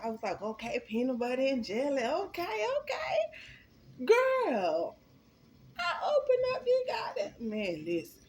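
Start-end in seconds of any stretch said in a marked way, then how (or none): 0.4-4.1 okay building from eager to squealing delight; rising mock excitement. Telling friends how she grabbed the sandwich, squealing higher. 4.1-7.5 much higher, louder, half crying it out; building up the reveal in a high wail; squealing with excitement. Real, not acted. Telling friends about the jail sandwich, high wailing build-up.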